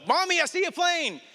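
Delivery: put-on high little-boy voice; excited toddler calling out; child voice. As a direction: pipe it up like a small boy. Son voiced in retold story.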